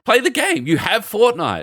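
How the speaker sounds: high-pitched, incredulous protest; exasperated retelling. Retold or imitated. Uncle telling his nephew to play Fortnite, exasperated high voice. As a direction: act out a baffled uncle, voice jumping high.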